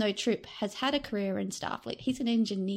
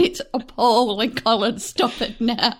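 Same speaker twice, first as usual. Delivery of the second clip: loud, emphatic mock telling-off with laughter; mock scolding; 8 dB louder than usual. Podcast guest teasing Colin about his Southern accent, mock scolding. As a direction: scold loudly, only in jest.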